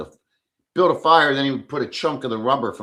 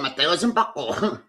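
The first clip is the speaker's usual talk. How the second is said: imitating a Filipina; 6 semitones higher than usual. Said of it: "What does that mean?".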